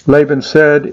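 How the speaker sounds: ordinary delivery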